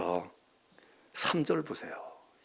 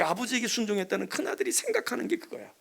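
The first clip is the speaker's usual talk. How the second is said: normal talking voice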